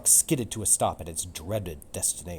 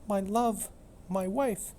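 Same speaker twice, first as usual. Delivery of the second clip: grieving; 13 semitones higher, slower than usual. Acted. Grieving Romeo.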